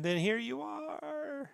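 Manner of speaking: drawn-out, high rising lilt; teasing singsong. Real, not acted. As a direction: stretch it slowly upward to tease. Teasing the co-host's return.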